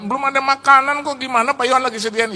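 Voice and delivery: high-pitched mock indignant guest; retold guest gossip. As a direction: spread the word in a high, loud voice. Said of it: "begitu ke teman-teman yang lain".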